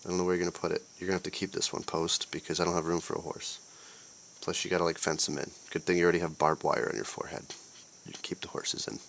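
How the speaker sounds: ordinary delivery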